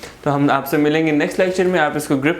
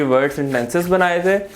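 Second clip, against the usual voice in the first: ordinary talk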